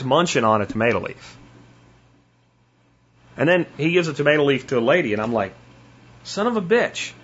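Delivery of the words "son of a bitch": high, disbelieving exclamation; acted-out stunned reaction; mock amazement. Acts out own stunned reaction.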